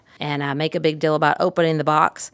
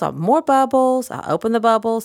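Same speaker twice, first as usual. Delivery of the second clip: high, lilting sing-song; Mister Rogers; 5 semitones higher than usual. Shown as a demonstration. Therapist catching a child's attention with bubbles, sing-songy. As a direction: lift it into a melodic children's host voice. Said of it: "See my Mister Rogers".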